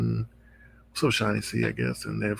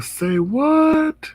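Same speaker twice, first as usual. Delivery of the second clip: high loud say what; shocked; 3 dB louder than usual. Retold or imitated. Host reacting to the threat, high shocked. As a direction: blurt it out in disbelief. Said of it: "I was like".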